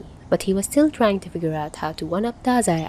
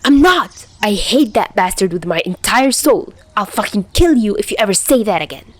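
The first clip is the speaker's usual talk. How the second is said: loud, furious scream; 4 semitones higher, 5 dB louder than usual. Acted. Chuya screaming at the retreating Tachihara. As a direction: scream it after him in outrage. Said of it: "Chuya screamed".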